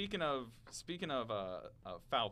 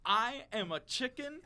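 high, loud, slow mock-solemn declamation; measured pundit proclamation, punching up each phrase; Stephen A. Smith; 11 semitones higher, 6 dB louder than usual. Pundit confessing to the turkey, high slow hammed-up declamation. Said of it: "what Stephen A quote would be your reaction".

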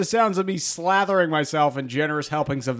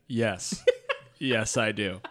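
laughing; 8 semitones lower, 6 dB quieter, slower than usual. Retold or imitated. Quoting own reply, laughing.

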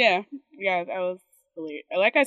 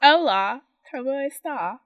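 higher-pitched, playful British-accented Spanish; 8 semitones higher, 4 dB louder than usual. Shown as a demonstration. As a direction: say the Spanish like a Brit showing off. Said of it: "I can speak Spanish with a British accent".

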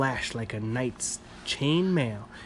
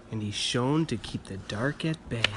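ordinary delivery

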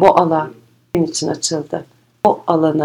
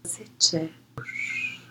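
soft spa whisper; 12 dB quieter, much slower than usual. Acted. Meditation guide, soft pause.

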